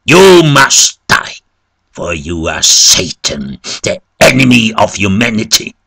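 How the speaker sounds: loud, high, vehement clergyman; forceful preacher-like shout; shouted refusal. A narrator's reading. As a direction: thunder the refusal like a condemnation. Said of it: "But the clergyman shouted".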